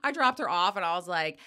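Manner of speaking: ordinary talk, nothing marked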